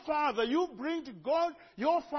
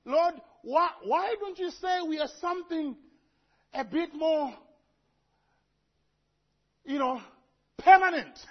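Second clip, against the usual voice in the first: higher, pleading question to God, rising to a loud 'permanent'; mock pleading prayer; 4 semitones higher, 5 dB louder than usual. Preacher voicing his complaint to God, mock pleading. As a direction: playfully whine at the Lord.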